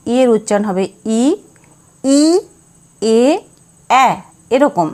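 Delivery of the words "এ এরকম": normal talking voice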